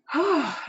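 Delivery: high, falling sigh; sigh of relief. Real, not acted. Client telling coach it feels lighter, sighing with relief.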